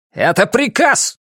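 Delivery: high, slow, emphatic order; angry command. A narrator's reading. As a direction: pull rank in anger. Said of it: "Услышав его гнев".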